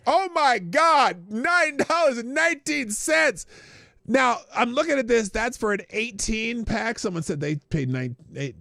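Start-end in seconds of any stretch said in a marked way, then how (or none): 0.1-3.4 high, loud, slow mocking repeat; scoffing slow echo of a politician; mocking incredulity. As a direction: parrot the figure in disbelief. Mocking repeat of played clip.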